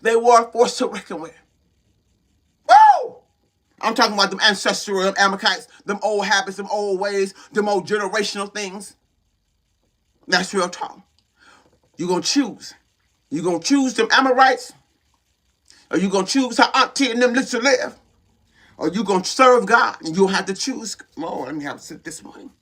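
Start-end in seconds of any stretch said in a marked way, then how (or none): 2.7-3.2 whoop. Real, not acted. Preacher whoops mid-sermon. 21.2-22.5 quiet aside. Real, not acted. Aside while pausing to drink.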